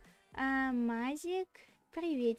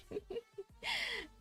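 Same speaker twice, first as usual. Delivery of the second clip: embarrassed high giggle; 9 semitones higher, 6 dB quieter than usual. Pretend. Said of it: "смущение за какие-то".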